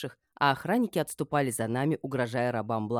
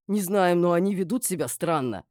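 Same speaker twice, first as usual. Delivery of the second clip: higher, louder, urgent report; worried report; 4 semitones higher, 5 dB louder than usual. A narrator's reading. Guard reports strange behaviour to the mistress, worried.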